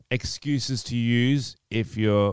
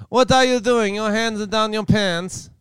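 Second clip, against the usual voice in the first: Borat impression; 11 semitones higher than usual. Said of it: "I'm running as Borat".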